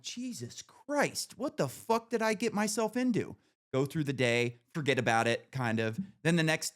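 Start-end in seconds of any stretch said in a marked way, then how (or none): 0.0-3.4 high, slow, drawn-out disbelief. Retold or imitated. Narrator to himself after the roommate incident, in disbelief. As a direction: let the disgust hang on it. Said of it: "And I'm like".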